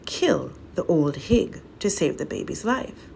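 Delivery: ordinary delivery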